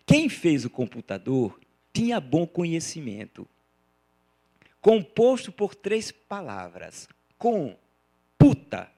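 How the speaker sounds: declaimed with deliberate pauses between split syllables; comic verse recitation. Acted. Lecturer quoting a popular poet for laughs, comic recitation. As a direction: recite it for the punchline, isolating each piece.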